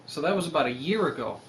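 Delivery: ordinary talk, nothing marked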